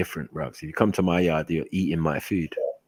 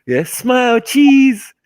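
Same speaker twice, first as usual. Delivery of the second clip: much higher, louder mock mum saying cheese; mum taking photo; 8 dB louder, much slower than usual. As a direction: chirp it like a mum behind the camera.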